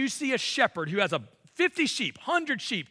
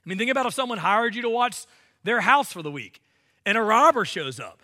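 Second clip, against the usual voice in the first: ordinary delivery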